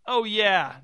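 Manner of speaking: high, loud retort; shouted. A narrator's reading. Jerry rebelling against the director's husband, shouted. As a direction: fire it back at full voice. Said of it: "Jerry shouted".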